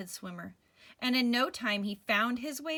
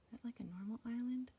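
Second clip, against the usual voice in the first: whispering; 10 dB quieter, faster than usual. Real, not acted. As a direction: whisper it to the little listener. Asides whispered to child.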